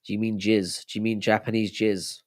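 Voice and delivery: usual speaking voice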